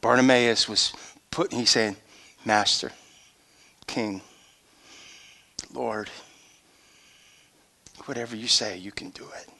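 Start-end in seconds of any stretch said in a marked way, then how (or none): 2.4-9.5 soft, lower, with long pauses; hushed and yielding, fading almost to a whisper; reverent surrender. Retold or imitated. Bartimaeus yields to Jesus, hushed reverent surrender.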